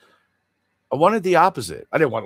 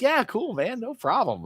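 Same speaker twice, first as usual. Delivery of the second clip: mock eager reply; 13 semitones higher than usual. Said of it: "I'm like".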